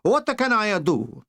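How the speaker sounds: a dictator whining over his fate; Italian Mussolini. Acted.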